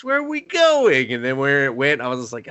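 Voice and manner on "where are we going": high-pitched comic bewilderment; retold baffled outburst; mock exasperated question. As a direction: demand an answer from the movie, pitch shooting up. Retells own confusion at the movie.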